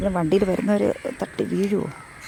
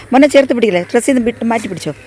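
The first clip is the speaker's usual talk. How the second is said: loud, raised instruction to a son; 3 semitones higher, 8 dB louder, faster than usual. Real, not acted. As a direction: call out a firm warning. Calling instructions to child holding flower. (മകനോട് ഉച്ചത്തിൽ നിർദ്ദേശം)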